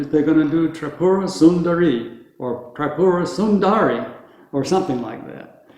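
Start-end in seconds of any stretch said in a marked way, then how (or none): 0.7-4.1 Tripura Sundari with English stress, sung up a bit; English-speaker mispronunciation. Retold or imitated. Teacher imitates English speakers mangling Tripura Sundari. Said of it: "they're gonna do that with them".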